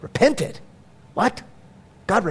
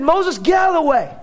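angry God; 5 semitones higher than usual. Retold or imitated. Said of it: "He said".

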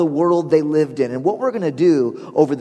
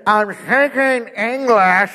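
high, drawn-out distorted words; slow garbled speaker; 8 semitones higher, slower than usual. Acted. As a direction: spell it out slowly through a bad speaker.

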